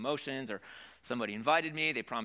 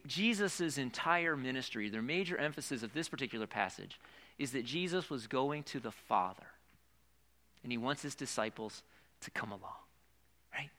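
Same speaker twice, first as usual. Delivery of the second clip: ordinary talk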